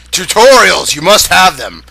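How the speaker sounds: hammed-up loud announcement; loud proclamation. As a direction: proclaim it grandly. Mocking a game character.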